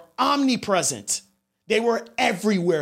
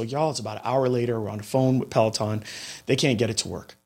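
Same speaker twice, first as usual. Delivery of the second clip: usual speaking voice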